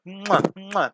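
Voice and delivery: two exaggerated "mwah" kisses; kissing sounds. Real, not acted. Teacher thanking a longtime viewer, blown kisses. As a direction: blow big smacking kisses.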